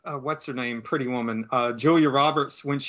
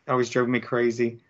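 ordinary talk

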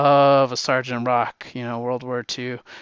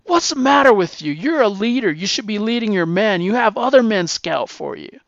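scolding captain; 7 semitones higher, 5 dB louder than usual. Retold or imitated. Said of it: "chewing Rock's ass".

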